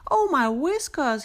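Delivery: alarmed little rabbit gasp; gasped in horror. A narrator's reading. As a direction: recoil in horror at the crack. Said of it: "he gasped in horror".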